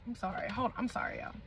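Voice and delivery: whispered apology off mic; hushed aside. Real, not acted. Apologizing to viewers mid-sip, whispered. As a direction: breathe the apology out.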